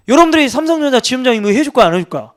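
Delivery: ordinary talk, nothing marked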